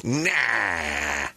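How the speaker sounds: imitating dad. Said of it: "He goes".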